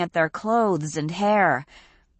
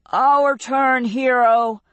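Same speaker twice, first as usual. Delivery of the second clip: louder and higher, daring; bold call; 8 semitones higher, 6 dB louder, slower than usual. A narrator's reading. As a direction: call him out over the wind. Fausto voiced.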